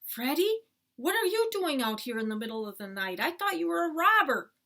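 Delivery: high, loud disbelief; startled scolding. Acted. Dad finding his son outside at night, startled scolding. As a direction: demand an explanation, still rattled.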